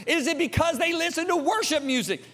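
high-pitched challenging question; dad grilling sons. Retold or imitated. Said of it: "I said".

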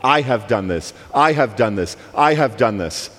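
"I have done this" with I pressed hard each time; stress on I. Retold or imitated. Preacher echoing the sister's reading, stressing "I". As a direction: lean on the "I", falling at the end, three times over. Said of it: "She was emphasizing".

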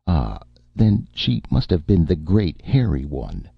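plain reading voice

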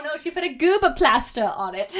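mock-British "plaster", loud and dismayed; British accent on plaster. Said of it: "say plaster in a British accent".

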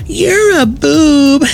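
put-on high, slow, sing-song little kid voice; little girl voice. Special ed kid teasing the principal, in a high, slow little-girl voice. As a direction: squeak it out like a six-year-old girl. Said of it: "he sounded like a little girl".

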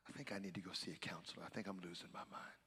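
hushed, quick, worn-out admission; quiet confession. Retold or imitated. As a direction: drop low and rush it like a secret. Telling wife he's done.

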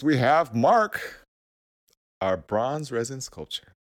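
ordinary talk

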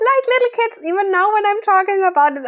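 high giggly voice, laughing. Real, not acted. Retelling the embarrassing sauna, laughing hard. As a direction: laugh through it, can't stop. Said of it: "I have to laugh so hard".

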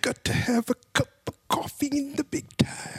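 high raspy wrestler voice; Macho Man Randy Savage. Retold or imitated. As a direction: growl it out like a wrestling promo. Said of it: "Macho Man Randy Savage".

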